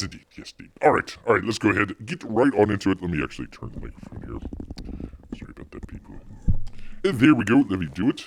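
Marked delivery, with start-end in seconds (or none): 5.4-6.1 whispered aside. Real, not acted. Quick aside off mic.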